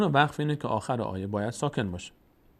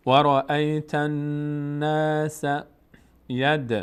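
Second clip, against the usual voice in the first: chanted very slowly with held nasal n; slow recitation; 2 semitones higher, 3 dB louder, much slower than usual. Shown as a demonstration. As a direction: recite slowly, letting the n hum. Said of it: "نگه داشتن صدا ... از بینی".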